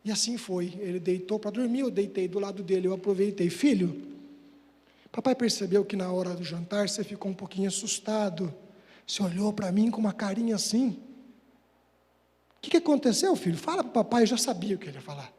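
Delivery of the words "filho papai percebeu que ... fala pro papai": put-on low, careful bedtime talk to a child; gentle father. Father soothing son at bedtime. (pai carinhoso, em tom baixo)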